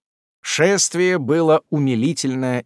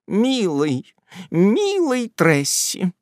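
tender endearment; 5 semitones higher, much slower than usual. A narrator's reading. Aunt tenderly addresses sleeping Tupman.

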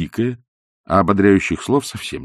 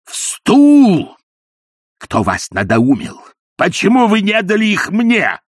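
shrieking outrage, higher and louder; furious high shouts; 13 semitones higher, 6 dB louder, slower than usual. A narrator's reading. Ippolit berating the dying old woman, high furious yells. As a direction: yell it like a cheated man.

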